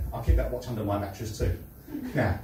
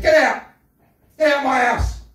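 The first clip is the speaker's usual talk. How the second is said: retold rage, shouted much higher and louder; furious shout; 11 semitones higher, 8 dB louder than usual. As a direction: roar them out of the house. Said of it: "I went mad".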